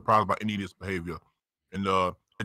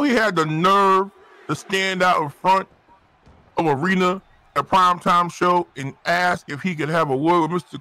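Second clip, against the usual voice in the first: indignant rant; 7 semitones higher than usual. Acted. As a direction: hammer out each complaint, pitched up and incredulous. Rant against a useless wrestler.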